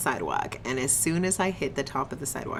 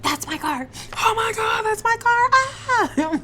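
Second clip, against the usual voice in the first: high, loud panicked scream; 5 dB louder, slower than usual. Retold or imitated. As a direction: scream as you see your stolen car. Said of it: "screaming my head off".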